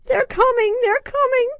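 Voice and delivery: put-on high, loud tearful little-girl wail; child wailing. Frightened child clinging to her mom, wailing. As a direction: wail it like a terrified small child. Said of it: "wailed Maya".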